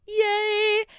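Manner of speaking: high, stretched-out little cheer; playful self-congratulating squeal; mock cheer. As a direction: cheer yourself in a high, drawn-out voice. Mock celebration of tiny fame.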